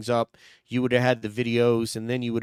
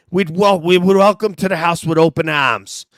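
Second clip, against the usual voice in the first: mock earnest declaration, louder; quoting Brian Albert; 5 semitones higher, 8 dB louder than usual. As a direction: proclaim the welcome a little too warmly. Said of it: "good impression of Brian Albert saying that".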